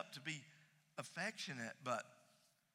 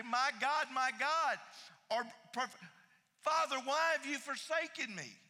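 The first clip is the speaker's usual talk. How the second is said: higher, louder cry of forsakenness; anguished cry; 8 semitones higher, 4 dB louder than usual. Retold or imitated. Jesus crying out on the cross in anguish. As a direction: cry out to the Father.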